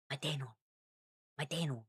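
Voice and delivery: soft, light Elvish take on Madenon; light Elvish accent. Shown as a demonstration. Streamer testing how Elvish would pronounce a name, light Elvish accent. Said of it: "I think of a light".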